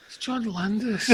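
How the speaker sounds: high, drawn-out awed plea; mock starstruck reply. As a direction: stretch it out high, as if it explains everything. Retold starstruck reply to agent.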